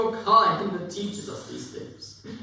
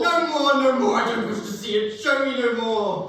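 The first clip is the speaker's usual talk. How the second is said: higher, louder cries of no more; anguished protest; 4 semitones higher, 5 dB louder than usual. Acted. Scrooge crying out to the Spirit in loud panic. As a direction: shut the vision out in panic.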